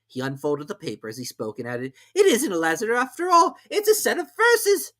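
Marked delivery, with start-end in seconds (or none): 2.1-4.9 high, loud hurried rabbit. A narrator's reading. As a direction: rush it as the rabbit.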